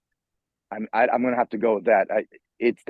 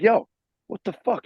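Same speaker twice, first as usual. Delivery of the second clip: raised-pitch indignant challenge; retold confrontation; 8 semitones higher than usual. Storyteller confronting Lars over the snub, indignant. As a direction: call him out, acting out the moment.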